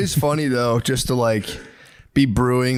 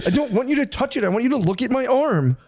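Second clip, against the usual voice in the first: whiny needy voice; 7 semitones higher than usual. Pretend. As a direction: beg for attention like a sulky partner. Acting needy to partner.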